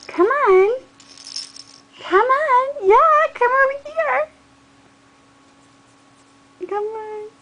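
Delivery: high loud cheering; excited coaxing. Real, not acted. Calling baby to crawl.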